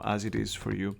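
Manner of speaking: normal talking voice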